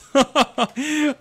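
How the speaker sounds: loud real laugh, high and bright; burst of laughter. Real, not acted. Laughing at his half-finished armour, a loud real laugh.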